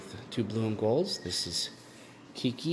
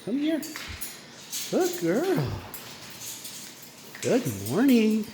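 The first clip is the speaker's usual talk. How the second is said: much higher sing-song pet voice, coaxing; 12 semitones higher than usual. Real, not acted. Calling bird out of cage.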